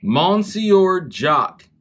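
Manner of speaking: Monsieur drawn out loudly as "mon-see-or", then Jock; naive misreading. Retold or imitated. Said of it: "you sound like a Southerner".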